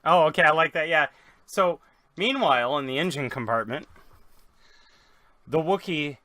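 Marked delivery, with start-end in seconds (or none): none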